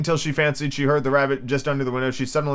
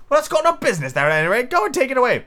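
high, quick, irritated order; high-voiced bossy master; cross Rabbit; 10 semitones higher, faster than usual. A narrator's reading. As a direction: dismiss the objection fast in a high voice.

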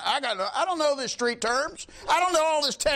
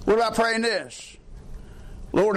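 normal talking voice

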